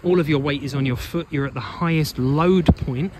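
ordinary talk, nothing marked

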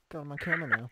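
usual speaking voice

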